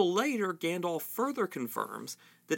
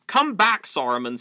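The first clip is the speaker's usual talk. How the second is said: commanding; 2 semitones higher, 8 dB louder than usual. A narrator's reading. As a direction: order him back with full authority. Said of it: "said Gandalf in a commanding voice".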